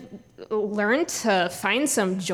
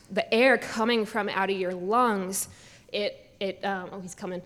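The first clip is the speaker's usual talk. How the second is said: normal talking voice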